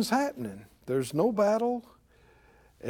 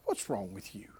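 mad; 12 semitones lower, 15 dB quieter than usual. Acted. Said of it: "made his brothers mad".

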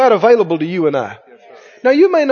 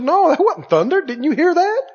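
higher, urgent protest; insistent bystander; 7 semitones higher than usual. Acted. Bystander disputing that it thundered, insistent. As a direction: argue it in a high, disbelieving voice.

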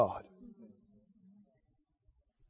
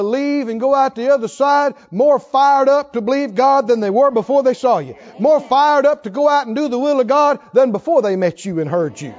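raised, forceful exhortation; impassioned preaching; 6 semitones higher than usual. Real, not acted. Preacher urging congregation to be encouragers, impassioned. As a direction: press the congregation hard with high, driving phrases.

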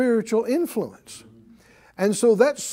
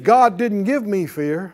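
normal talking voice

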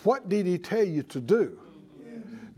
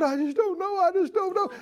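whiny doubter; 10 semitones higher, faster than usual. Retold or imitated. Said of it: "Talking like that".

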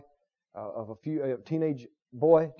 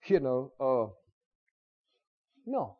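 normal talking voice

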